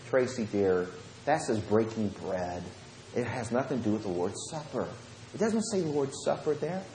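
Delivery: soft, measured, condescending explanation; patronizing correction. Retold or imitated. Retelling a preacher correcting his member, softly patronizing. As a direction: correct gently as if talking down.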